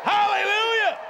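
high, ringing shout; exultant praise cry. Real, not acted. Preacher stirring the congregation to laugh and praise, exultant shout. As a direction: holler it up high.

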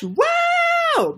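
loud, very high squeal of delight; excited wow. Real, not acted. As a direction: squeal at the like count. Reacting to likes.

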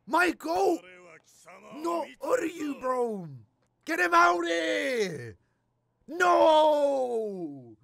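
very slow, high, loud hammed-up despair; anguished wail. Real, not acted. Watching his favourite lose, a hammed-up slow anguished wail. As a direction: wail it out like your hero just fell.

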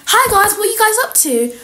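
high-pitched hopeful hello; over-eager greeting. Acted. Outcast girl approaching old friends, over-eager greeting. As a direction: pipe up, keen to join in.

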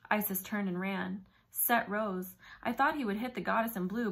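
plain reading voice

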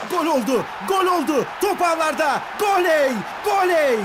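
ecstatic goal scream, high and loud; screaming football commentator. Acted. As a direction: belt out the goal call at full voice. Goal scored in match commentary.

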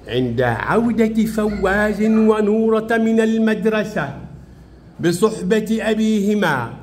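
measured, drawn-out classroom reading; slow reading aloud. Shown as a demonstration. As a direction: read it out very slowly for pupils. Reads the lesson sentence aloud. (قراءة تعليمية بطيئة وممدودة)

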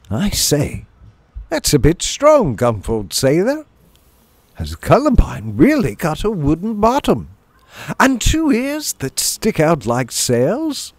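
high, hammed-up banter; teasing comic chums. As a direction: tease with mock astonishment, pitched high. Voices Trubs and company teasing.

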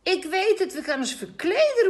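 put-on high, loud eager child's call; excited call. A narrator's reading. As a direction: call it out, bursting with the idea. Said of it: "roept Jimmy".

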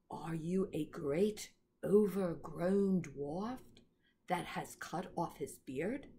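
low, slow, imperious queen; cold and commanding, looking down on him; stern queen. Acted. Queen interrogates Edmund.

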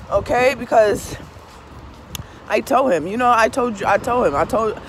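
ordinary talk

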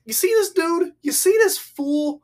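high, loud, pointed address to a crowd; single someone out for the crowd; mocking call-out. Jesus making an example of the man, mocking.

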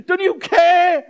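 put-on very high, loud, drawn-out childish cry; wailing little kid. Disciples to Jesus like little kids, wailing. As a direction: stretch it into a child's screaming plea. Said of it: "Like little kids".

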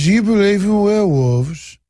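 put-on Cockney question; Oliver Twist Cockney. Interviewer asking his guest in the fog, mock Cockney. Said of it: "that Oliver Twist Cockney accent".

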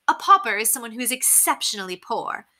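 high-pitched, affected society lady; haughty lady. A narrator's reading. Lady explaining paupers to the children, haughty. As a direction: explain it grandly, high-voiced. Narrator plays Lady Constance.

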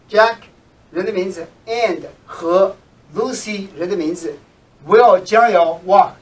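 ordinary delivery

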